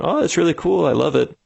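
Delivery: high, breezy fake praise; generic compliment. Customer giving the founder a generic compliment, breezy and high. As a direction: toss off an empty compliment. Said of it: "they answer with the generic compliment".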